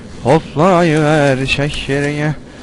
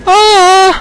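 sudden high shriek of fright; startled scream; 10 dB louder than usual. Real, not acted. Streamer jolted by a jump scare, high shriek. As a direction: yelp like something grabbed him.

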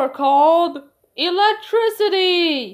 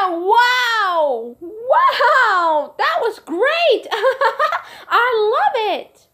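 excited; 9 semitones higher, 4 dB louder, much slower than usual. Acted. Kids amazed by trick.

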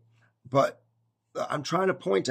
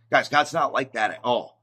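usual speaking voice

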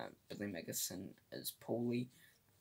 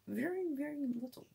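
squeaky, much higher mock dismay at the figure; 4 dB louder than usual. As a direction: squeal it out in fake disappointment. Mock dismay at box office.

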